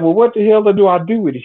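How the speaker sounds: high, impatient retold question; exasperated demand. Man demanding how to use the rock salt, exasperated. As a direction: pitch it up in exasperation.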